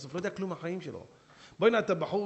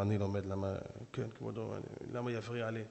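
lower, softer, unhurried reply; saintly rabbi answers the frantic sheikh calmly; 7 semitones lower, 7 dB quieter than usual. Acted. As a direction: answer serenely.

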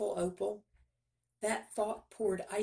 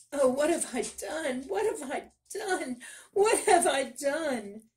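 higher, louder anguished cry, slowing on the last; despairing wail; 8 semitones higher, 4 dB louder than usual. Acted. Miller despairing after his daughter is taken, crying out. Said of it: "He buried his face in his hands".

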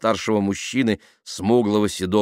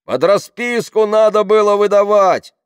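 high, slow, loud heckle; shouted; 9 dB louder than usual. A narrator's reading. People behind accusing the driver, shouted. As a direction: yell it from the back. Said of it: "крикнули сзади".

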